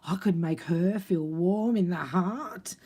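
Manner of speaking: hammed-up dawning excitement; mock delighted realisation. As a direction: build up in pitch like a thrilled discovery. Acts out man delighted by idea.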